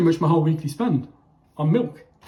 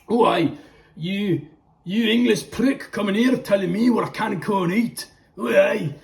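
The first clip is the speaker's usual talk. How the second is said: higher, hammed-up Scottish accent as an angry local; 6 semitones higher, 4 dB louder than usual. Viewer mocked as angry local, hammed-up Scottish accent. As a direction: put on a mock Scottish brogue to rant at the English. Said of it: "I'll do a Scottish accent instead".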